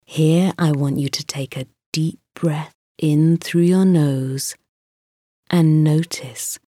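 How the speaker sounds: ordinary delivery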